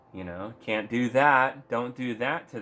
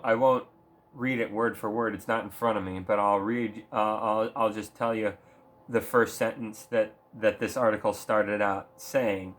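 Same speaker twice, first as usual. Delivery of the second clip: ordinary talk, nothing marked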